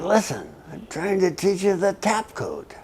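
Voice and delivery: high, hushed urgent instruction; stage whisper. Retold or imitated. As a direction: whisper it fast through the door. Prisoner whispering code lesson.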